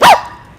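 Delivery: sudden high yelp of pain; mock pain scream. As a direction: imitate a shocked primate's cry. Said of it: "he'll scream out in pain".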